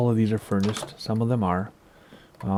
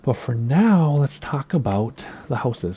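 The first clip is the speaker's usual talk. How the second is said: usual speaking voice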